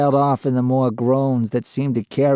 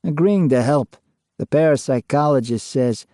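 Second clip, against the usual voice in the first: ordinary delivery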